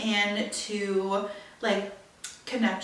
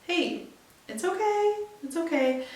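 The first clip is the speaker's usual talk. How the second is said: high, soothing reassurance; high, gentle it's okay; reassuring self-talk; 8 semitones higher than usual. Retold or imitated. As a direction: lift the voice to calm yourself. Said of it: "I just had to tell myself".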